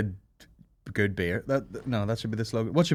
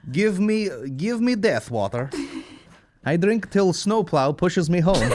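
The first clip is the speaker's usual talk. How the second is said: mock Russian; 3 semitones higher, slower than usual. Mock Russian drinker quote.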